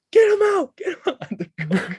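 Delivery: very high-pitched frantic yell, falling off at the end; imitated grandma yelling. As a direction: shriek it like a panicked grandma. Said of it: "she starts yelling".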